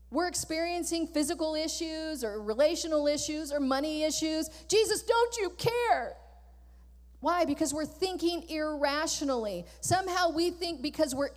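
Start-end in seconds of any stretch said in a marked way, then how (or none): 4.7-6.2 higher, slow whining complaint; mock whine. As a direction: whine it like a spoiled child. Mimicking us blaming Jesus.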